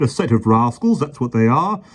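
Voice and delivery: high, loud blustering officer; pompous policeman. A narrator's reading. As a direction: lay down the law pompously.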